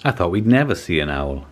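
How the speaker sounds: usual speaking voice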